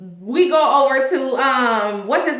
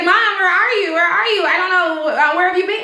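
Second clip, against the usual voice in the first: worried son; 6 semitones higher than usual. Retold or imitated. Son questions absent mother.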